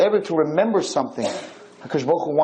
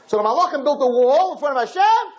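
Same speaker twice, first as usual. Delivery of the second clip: high, louder, urgent telling; heated storytelling; 11 semitones higher, 4 dB louder than usual. Acted. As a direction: build the drama of the wall. Climax of the story.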